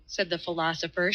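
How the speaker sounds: plain reading voice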